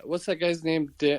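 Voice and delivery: normal talking voice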